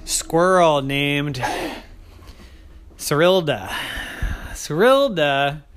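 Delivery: slow, emphatic reveal; slow name reveal. Acted. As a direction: savor the name slowly.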